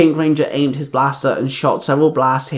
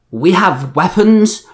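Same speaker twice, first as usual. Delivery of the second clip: slow, higher-pitched surprised bark; barked question; 5 semitones higher, 4 dB louder, slower than usual. A narrator's reading. Two Rangers react to news of weapons, barking. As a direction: bark it back in disbelief.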